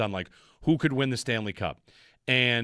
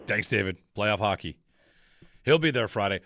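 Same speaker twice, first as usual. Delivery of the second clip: normal talking voice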